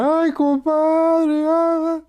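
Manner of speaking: put-on high, drawn-out tearful wail of a drunk; slow, loud, sobbing lament; drunken weeping. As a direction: let it all pour out like a drunk crying to his buddy. Drunk man finally weeping.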